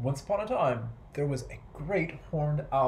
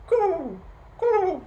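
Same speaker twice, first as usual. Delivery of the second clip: high, long, hollow coos; drawn-out high dove call; dove cooing; 5 dB louder than usual. A narrator's reading. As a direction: coo out slowly like a dove. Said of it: "They sound like".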